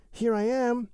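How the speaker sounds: a friendly otter bounding in; Little Joe Otter voice. A narrator's reading. Joe Otter announces himself.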